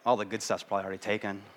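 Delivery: mild pessimist grumbling at a candy box; pessimistic grumble. Retold or imitated. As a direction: expect the worst and rush through it. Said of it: "if you're pessimistic".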